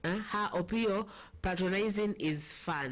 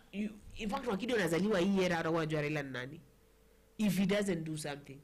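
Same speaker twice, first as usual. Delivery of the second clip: ordinary talk, nothing marked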